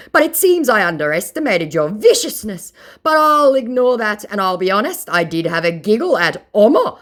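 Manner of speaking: indignant mock scammer. Mocking the scammer's complaint, high whiny mock voice. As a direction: sound put-out and whiny.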